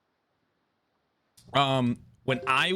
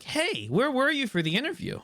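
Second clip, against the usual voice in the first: mock recruiter voice; 12 semitones higher than usual. Mimicking the recruiter's email.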